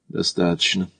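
soft, weary cut-off; tired sigh. A narrator's reading. Макдональд giving up on the car, tired. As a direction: sigh it out wearily. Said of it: "устало вздохнул Макдональд".

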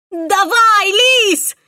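high, loud desperate scream; shrill scream. A narrator's reading. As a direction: scream with all you have. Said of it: "истошный вопль девушки".

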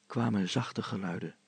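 ordinary delivery, nothing marked

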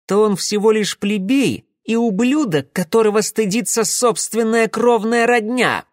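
high-pitched, heated contempt; scornful. A narrator's reading. Alyoshka dismisses Merkulov with fists clenched, scornful.

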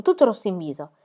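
ordinary delivery, nothing marked

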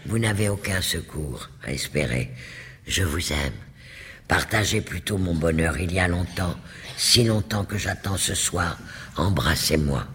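low breathless seduction; low, panting voice. A narrator's reading. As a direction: press her in a low panting voice. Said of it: "d'une voix basse, haletante".

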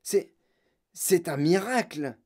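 halting, slow wonder; falter, then draw out the marvel; stunned grandfather, lost for words; gasped amazement. A narrator's reading. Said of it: "suffoqua grand-papa Joe".

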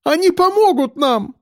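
high-pitched desperate plea; tearful cry. A narrator's reading. Woman hopes strangers will help, tearful high cry. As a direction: plead through tears. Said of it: "крикнула она, всхлипывая".

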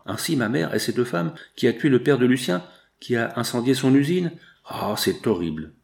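ordinary delivery

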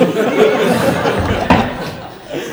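big burst of real laughter, high and loud; a loud, high, helpless laugh. Real, not acted. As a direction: break into open laughter. Laughing about wanting the Zoom.

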